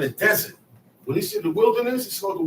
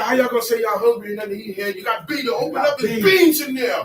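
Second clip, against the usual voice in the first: put-on higher and louder, shouting at lazy kids; exasperated shout; 5 semitones higher, 5 dB louder than usual. A father shouting at his kids to go cook. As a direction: yell at them that there's food in the house. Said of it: "You said".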